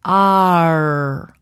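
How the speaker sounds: "ar" held long, open ah then R; clean ar. Shown as a demonstration. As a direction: stretch out a clear "ar". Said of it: "say it with the vowel ah and produce".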